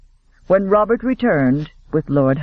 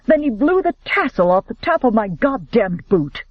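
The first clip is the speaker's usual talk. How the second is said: furious rant; 5 semitones higher than usual. A narrator's reading. Robert furious about the duel.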